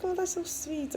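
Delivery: a touch of a gushing shop assistant; cooing shop assistant. Retold or imitated. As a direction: coo it like a charmed saleswoman.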